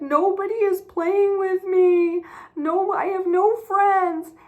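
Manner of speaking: put-on a boy wailing his complaint in a high, loud voice; boy on the playground crying to the girl, high and loud; crying little boy. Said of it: "he was crying and he said".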